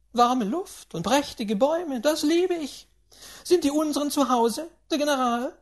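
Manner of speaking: put-on high, loud, domineering old lady berating; a rich old tyrant who brooks no nonsense; imperious grandaunt. A narrator's reading. Grandaunt scolding the tutor and her steward, high and commanding.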